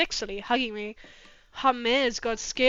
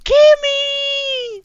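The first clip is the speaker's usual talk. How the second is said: high, loud call across the room; much higher, louder drawn-out call; 13 semitones higher, 11 dB louder than usual. Real, not acted. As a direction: shout the name to greet someone. Calling out to a viewer.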